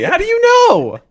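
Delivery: high, incredulous how do you know; indignant disbelief. Real, not acted. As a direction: squeak it out in disbelief. Indignant pushback on pizza claim.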